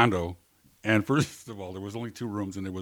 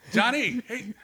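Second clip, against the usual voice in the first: higher-pitched, imitated nervous call for a friend; panicky calling; 10 semitones higher, slower than usual. Alone and calling for his missing friend, mock panic. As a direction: act out rising panic while calling out. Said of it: "hear the panic start in his voice".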